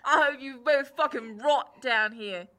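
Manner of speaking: wounded jailer cursing the prisoners; furious jailer. Acted. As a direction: spit out a bitter curse. GM voices the angry jailer.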